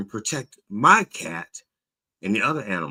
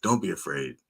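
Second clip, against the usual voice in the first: ordinary talk, nothing marked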